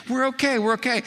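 high, sing-song calming voice; calm the boatman with a lifted, patronizing tone; soothing reassurance. Retold or imitated. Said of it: "John Gerstner says".

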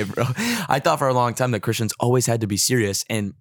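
ordinary delivery

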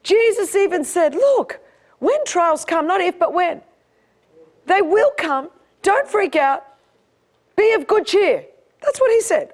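high-pitched, driving sermon delivery; full-force preaching. Acted.

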